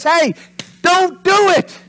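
high, loud, bold warning; in-your-face shout. Retold or imitated. God warning right in Adam's face, bold shout. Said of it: "right there in his face".

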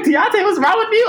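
high, startled mock girlfriend voice. Mary woken at four a.m., startled and confused. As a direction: squeak it like a shocked roommate woken at night. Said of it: "She's like".

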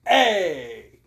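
put-on big drawn-out New York accent, calling out. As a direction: put on a brash cartoon New Yorker. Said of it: "we're talking with a New York accent".

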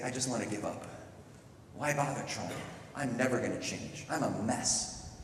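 soft, defeated self-talk; discouraged. Acted. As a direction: give up quietly, acted. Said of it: "you can feel like Eleanor".

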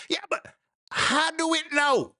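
hammed-up yokel punchline, drawn out; high slow put-on country voice; old country boy.

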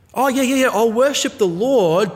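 high, loud, breezy yes; mock eager agreement. Worshipper promising to worship the Lord, glibly eager. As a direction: agree too readily, hammed up. Mimics half-hearted worshipper who demands changes.

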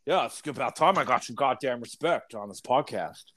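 lightly voiced wrestler-governor; Jesse the Body Ventura. Special guest demanding respect on the podcast, wrestler impression. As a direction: play the self-important guest demanding respect. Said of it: "all-American Jesse the Body".

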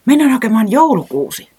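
high, loud, eager suggestion; light up at the idea; excited. A narrator's reading. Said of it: "Reporankka innostui". (korkealla ja kovaa, innostuneesti)

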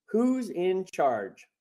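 normal talking voice

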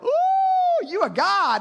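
high, breathy-free mock awe from the crowd; awed islanders. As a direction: squeal it like wide-eyed islanders. Maltese people awed by Paul.

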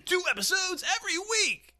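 high, loud shout; shouted announcement. Acted. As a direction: yell it out clearly. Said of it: "you said it like".

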